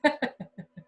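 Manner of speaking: a quick real laugh, breaking off; short laugh. Real, not acted. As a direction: laugh at the stray game audio. Laughs at hockey audio.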